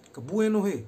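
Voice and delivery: "Kebuenohe" yelled high and loud; cursing shout. Shown as a demonstration. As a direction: bark "Kebuenohe" at a pest. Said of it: "Logatnya begini".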